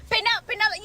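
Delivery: high mocking boss voice; mocking coworker. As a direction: imitate him scolding her. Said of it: "He goes".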